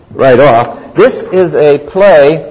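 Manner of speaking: usual speaking voice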